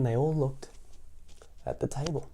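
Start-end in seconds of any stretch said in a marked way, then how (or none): none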